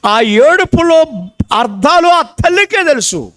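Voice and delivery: high, rising sermon cry, building to a shout; preaching at full force. Acted. Preacher to congregation about crying babies, building full-force preaching.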